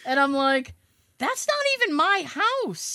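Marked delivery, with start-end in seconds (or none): 1.2-2.9 high exasperated retort. Retold or imitated. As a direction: toss it off, incredulous and high. Retold exasperated reply.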